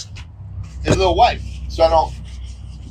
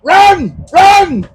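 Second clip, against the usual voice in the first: high, louder cries of run; mock shout; 8 semitones higher, 4 dB louder, much slower than usual. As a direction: yell it like a movie warning.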